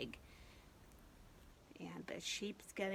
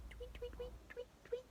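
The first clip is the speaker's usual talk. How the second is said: very high, soft tweets; bird tweet; 9 dB quieter, faster than usual. Shown as a demonstration. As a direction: chirp like a little bird. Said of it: "What's a bird say?".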